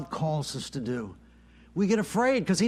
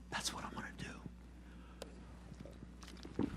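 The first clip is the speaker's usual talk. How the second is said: near-silent whispered vow; hushed vow; 22 dB quieter, much faster than usual. Acted. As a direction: drop to a private whisper. Personal vow to the Lord.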